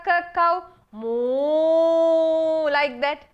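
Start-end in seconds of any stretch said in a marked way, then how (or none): 0.9-2.7 long drawn-out mock cow moo. Shown as a demonstration. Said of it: "Give the sound of the cow".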